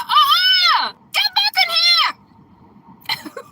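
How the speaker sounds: high, loud hollered order; dragon's shout. Retold or imitated. Dragon ordering the escaped woman back, shouting high and loud.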